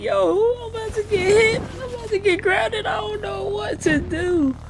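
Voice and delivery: very high squeaky panicked voice; high hammed-up fear; mock panic. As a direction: freak out in a tiny voice. Panic before punishment.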